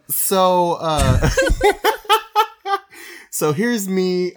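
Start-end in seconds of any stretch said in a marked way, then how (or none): 1.3-2.8 high laughing fit; real laughter before a story; high giggling laugh. Real, not acted.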